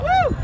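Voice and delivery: very high "woo"; excited whoop. Pretend. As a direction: squeal a quick cheer. Performer cheering her own trick.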